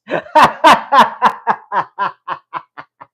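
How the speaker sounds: real burst of high laughter; helpless loud laugh; loud laughing fit. Real, not acted. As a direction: crack up out loud at the comment. Bursts out at viewer comment.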